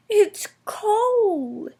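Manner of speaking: high whispered cold shiver. A narrator's reading. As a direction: breathe it out shivering. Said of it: "shivered Percy".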